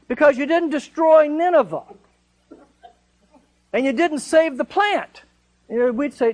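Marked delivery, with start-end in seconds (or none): 0.1-5.2 indignant Jonah. Retold or imitated. Jonah voiced justifying his anger.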